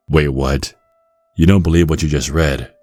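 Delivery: ordinary talk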